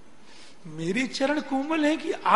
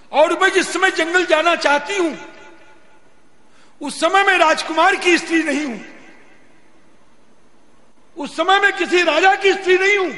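higher, louder, eager reasoning; excited Sita; 7 semitones higher, 4 dB louder than usual. Acted. Sita convinces herself she may go to the forest, excited and loud. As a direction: argue your way out with delight.

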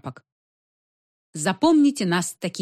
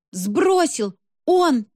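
high, louder cry of alarm on the last word; shrieking; 8 semitones higher, 3 dB louder, much slower than usual. A narrator's reading. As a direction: squeal it in shocked bursts. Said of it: "заверещала я".

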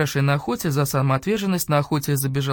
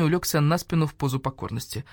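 usual speaking voice

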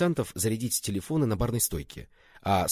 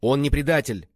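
raised, loud, firm denial; curt retort; 5 semitones higher, 6 dB louder than usual. A narrator's reading. Swordsman defending the accused man, curt loud retort. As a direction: cut him off with a denial. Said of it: "отрезал Лун Бэйхуа".

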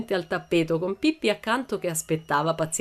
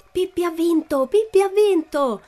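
high, jubilant cry building louder; crowd shouting victory; 13 semitones higher, 6 dB louder than usual. A narrator's reading. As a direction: proclaim the win like a cheering audience. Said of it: "si gridava da ogni parte del circo".

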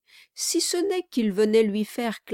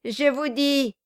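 loud, rising excitement; loud exalted boast to wife; exalted dwarf; 6 semitones higher, 4 dB louder than usual. A narrator's reading. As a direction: work himself up. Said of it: "s'exaltant à l'idée de son projet".

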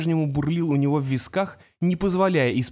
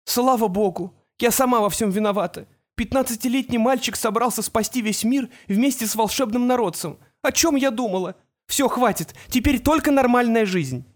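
tearful relief; 7 semitones higher than usual. A narrator's reading. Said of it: "Женщина заплакала в трубку".

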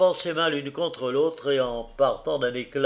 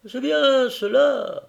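high, loud, needling voice; sly Tackleton; 11 semitones higher, 5 dB louder, slower than usual. A narrator's reading. Tackleton needling the carrier about his wife, high and loud. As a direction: prod the husband with mock respect.

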